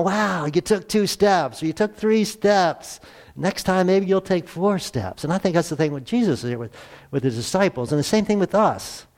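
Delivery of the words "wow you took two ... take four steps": put-on slow, high, smiling praise to a toddler; encouraging smile. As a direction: cheer the little one's first steps. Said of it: "You smile".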